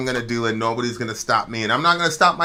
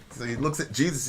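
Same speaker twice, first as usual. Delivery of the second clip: usual speaking voice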